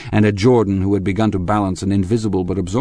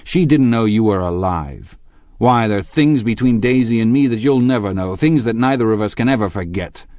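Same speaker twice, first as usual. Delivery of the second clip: loud, raised, biting attack; savage; 5 semitones higher, 5 dB louder than usual. A narrator's reading. Tom taunts Gatsby about his marriage, savagely. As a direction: make the words bite into the rival. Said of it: "said Tom savagely".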